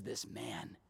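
very low, soft, dismissive drop; hushed, offhand disowning; nonchalant. Acted. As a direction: toss it away under your breath. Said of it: "That nonchalant".

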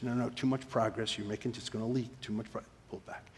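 quick hushed correction; quiet coaching at close range; imitated whispering mentor. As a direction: murmur it fast, fading to near whisper. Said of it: "he's whispering to me".

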